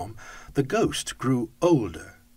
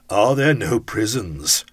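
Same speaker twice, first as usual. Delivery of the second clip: louder, slow, cutting retort; stern rebuke; 8 dB louder than usual. Acted. Ghost turns Scrooge's words on him, loud stern rebuke. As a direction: hurl his own words back at him.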